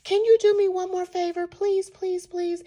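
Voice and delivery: high-pitched imitated begging; acted-out, imploring request; pleading. Woman begs one more favour.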